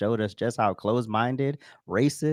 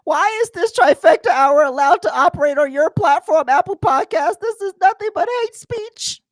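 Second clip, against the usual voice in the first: much higher and louder, whiny mock reading; 9 dB louder than usual. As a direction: read it like a shrill outraged critic. Reading another hateful comment.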